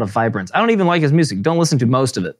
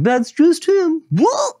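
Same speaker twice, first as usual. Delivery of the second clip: mock character line; 13 semitones higher, slower than usual. Odd Couple voiced line.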